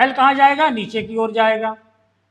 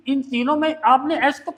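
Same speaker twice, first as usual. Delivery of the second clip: usual speaking voice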